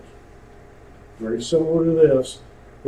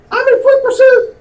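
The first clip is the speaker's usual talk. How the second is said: much higher and louder, squeaky panic; panicked high shout; 10 dB louder than usual. Retold or imitated. Instructor imitating an officer starting a foot chase, excited high yell. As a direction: shriek it like an officer suddenly running. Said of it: "Voice went up about ten octaves".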